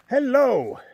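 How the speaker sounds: loud, high hello across a distance; calling out. Real, not acted. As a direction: call the greeting out high and loud. Calling out a greeting to others.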